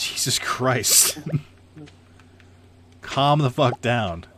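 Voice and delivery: loud, high, drawn-out plea with a laugh; laughing exasperation. Real, not acted. Streamer reining in an unruly co-host, exasperated and laughing. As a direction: stretch the words out in exasperated protest.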